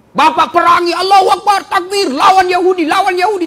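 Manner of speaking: loud, high call to fight, rousing the crowd; rallying shout. Real, not acted. Preacher rousing the congregation to fight, loud rallying shout. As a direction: shout the takbir to stir the room.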